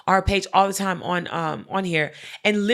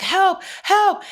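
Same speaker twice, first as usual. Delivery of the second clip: mock desperate plea; 11 semitones higher, 4 dB louder than usual. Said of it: "the guy is still like".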